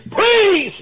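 high, loud begging please; pleading cry. Retold or imitated. Judah pleading with Mister Governor, high loud cry. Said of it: "Judah pleaded and said".

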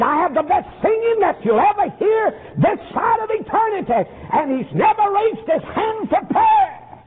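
high sustained chanted preaching; sing-song preacher's whoop. Retold or imitated. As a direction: whoop the line like a revival preacher. Preacher chanting quoted pastor.

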